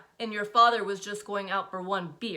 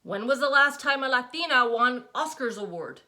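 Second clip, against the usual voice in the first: higher, louder rhetorical jab; challenging mother; 6 semitones higher, 9 dB louder than usual. A narrator's reading. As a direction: fling the question at her.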